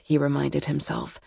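usual speaking voice

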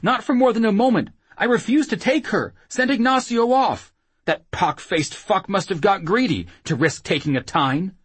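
high, anxious protest; pleading. Acted. Whoremaster denying he kept her, desperate pleading. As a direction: plead your case in a high, urgent voice. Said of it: "Sancho pleaded".

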